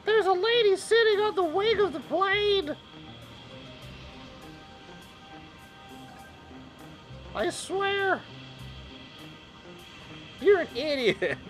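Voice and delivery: very high-pitched, hammed-up yell; dubbing voice. Reactor dubbing the cartoon for viewers, hammed-up high voice. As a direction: squeal it out like a cartoon dub. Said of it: "I provide some voice, uh, dubbing".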